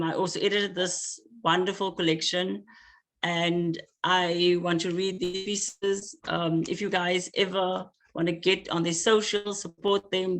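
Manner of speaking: usual speaking voice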